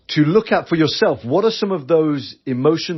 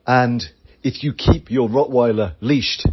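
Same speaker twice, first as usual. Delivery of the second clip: ordinary talk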